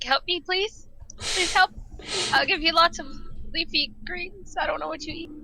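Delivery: high, begging little voice; pleading squeaky voice. Acted. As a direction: beg the dinosaur sweetly. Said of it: "and be like".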